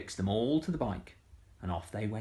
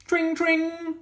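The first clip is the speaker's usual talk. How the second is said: squeaky high "tring tring"; high bell ring; 7 dB louder than usual. A narrator's reading. Bicycle bell in story.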